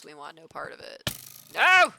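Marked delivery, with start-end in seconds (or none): 1.5-1.9 high, loud cry of refusal; alarmed shout. Real, not acted. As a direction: yell it in sudden alarm. Startled by danger in game.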